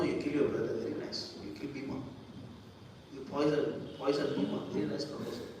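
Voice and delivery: a soft, half-whispered mock approval; sly approving king. Dhritarashtra urging his sons to kill Bhima, hushed sly approval. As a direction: purr approval of the wicked plan, hushed.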